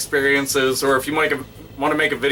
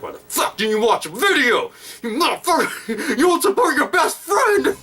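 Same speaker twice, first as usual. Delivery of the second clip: high, hammed-up wail of betrayal; fake-cry the complaint, voice pitched way up; mock tearful outburst; 11 semitones higher than usual. Vlogger scolds friends who skipped the video, mock crying.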